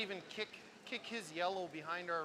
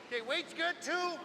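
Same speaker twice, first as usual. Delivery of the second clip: excited calling; 10 semitones higher, 5 dB louder, slower than usual. Real, not acted. Calling the shot.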